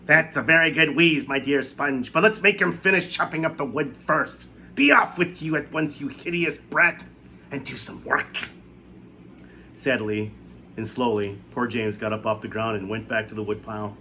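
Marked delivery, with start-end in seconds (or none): 0.1-8.5 higher, louder, scornful voice; spiteful aunt. A narrator's reading. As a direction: sneer at the brat. Aunt Spiker scolds James.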